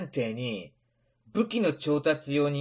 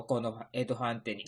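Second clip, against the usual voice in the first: normal talking voice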